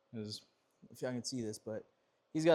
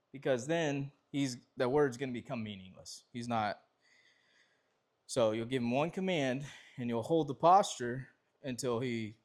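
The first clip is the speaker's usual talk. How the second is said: usual speaking voice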